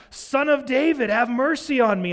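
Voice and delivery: high, loud desperate cry; yelling out. Retold or imitated. Blind beggar shouting to passing Jesus, pleading. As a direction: beggar crying out for Jesus over the crowd. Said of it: "the blind guy starts yelling out".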